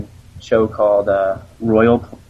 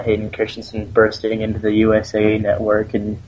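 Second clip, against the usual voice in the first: ordinary delivery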